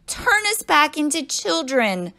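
high, loud, slow insistence; demanding. A narrator's reading. Pupil turned apple to teacher, demanding. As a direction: order it firmly, stretching the words. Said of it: "Todd demanded".

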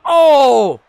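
loud, high "oh!" at a big hit; shocked shout. Real, not acted. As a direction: cry out at the impact. Reacción a golpe fuerte. (grito fuerte de asombro)

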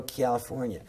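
"California" said as kee-al-ifornia; "California" in a sketch Californian accent. Shown as a demonstration. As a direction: slip a y-glide after the C of "California". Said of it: "I talk like that sometimes".

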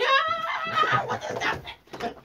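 a startled shriek turning into a breathless question; hammed-up jump at a roach; mock fright. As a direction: play the scared one who spots a bug. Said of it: "But you're fearful".

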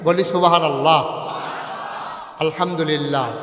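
preacher leads the crowd in chanting praise; call and response. Real, not acted. Preacher rehearsing the crowd's responses, call and response. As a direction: lead the crowd, each phrase called out. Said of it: "একটু practice করি".

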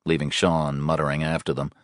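ordinary delivery, nothing marked

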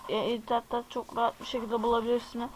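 normal talking voice

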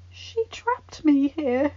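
high, slow, distressed; throat growing tight. A narrator's reading. Child realising he is trapped, throat tight. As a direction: let it tighten and drag, pitched high. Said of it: "feeling his throat growing tight".

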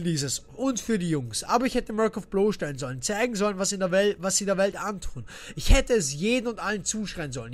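low, soft narrated reading; reading notes aloud. Acted. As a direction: read the diary quietly, low. Reads in-game diary aloud.

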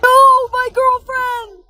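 drawn-out cry of grief; anguished wail. Acted. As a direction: wail over a lost girlfriend. Girlfriend disappears.

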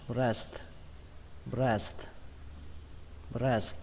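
"breast" with both final s and t sounded; full st ending. Shown as a demonstration. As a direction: say "breast", closing on a crisp s then t. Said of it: "kết thúc bằng âm sờ và âm tờ". (đọc "breast" rõ cả âm s và âm t cuối)

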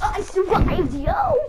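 excited. Acted. Triumphant silly call.

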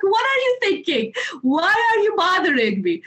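high, whiny mock outrage; a tired teen complaining to her father; whiny indignant kid. Retold protest at waking father.

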